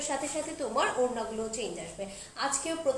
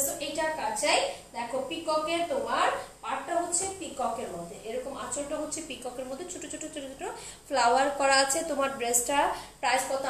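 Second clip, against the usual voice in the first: ordinary delivery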